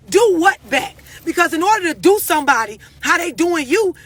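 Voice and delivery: usual speaking voice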